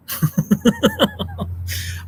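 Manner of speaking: a very high, gleeful squeal of laughter. Real, not acted. Amused by a viewer's take on politics, squealing with laughter.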